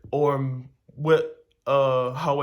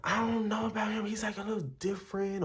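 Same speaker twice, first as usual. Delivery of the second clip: put-on gossiping girlfriend; 8 semitones higher, 6 dB quieter than usual. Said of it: "she used to always talk to us, like".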